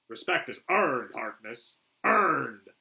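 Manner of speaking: "earned" drawn out and loud; stern stress on earned. Acted. Flag rebukes Boomer, "earned" punched loud. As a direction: hit "earned" hard, especially alone.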